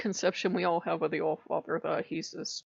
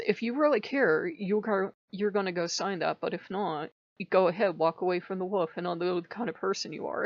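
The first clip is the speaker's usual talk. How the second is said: normal talking voice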